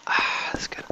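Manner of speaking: soft whispered relief; satisfied whisper. Real, not acted. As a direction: breathe it out, pleased.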